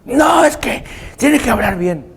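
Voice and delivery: high-pitched mock protest; pedantic uncle. Uncle insisting grandmother must speak properly, high mocking protest. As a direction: insist fussily in a caricature voice.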